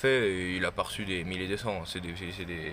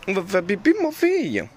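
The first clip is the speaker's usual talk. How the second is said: much higher, louder singsong pet voice; 10 semitones higher, 9 dB louder than usual. Real, not acted. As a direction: coo at the dog in a high voice. Calling the dog out to pee.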